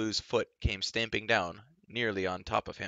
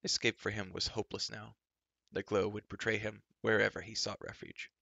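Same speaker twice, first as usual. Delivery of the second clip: usual speaking voice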